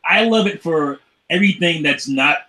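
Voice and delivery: ordinary talk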